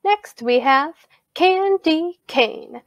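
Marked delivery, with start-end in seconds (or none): 1.3-2.8 "candy cane" slowly in three beats, starting high; syllable by syllable. Shown as a demonstration. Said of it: "listen very carefully to how I say those".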